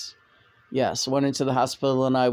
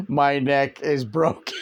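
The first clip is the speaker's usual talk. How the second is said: laughing; 2 semitones lower than usual. Retold or imitated. Imitating the terrified woman.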